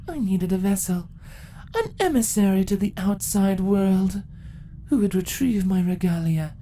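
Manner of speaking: scheming empress. Acted.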